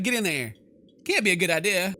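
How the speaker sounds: ordinary talk, nothing marked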